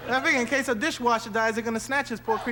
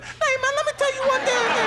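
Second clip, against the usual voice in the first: high angry voice; 4 dB louder than usual. Retold or imitated. Turning back to the other guy, very high and mad. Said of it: "they talk real high".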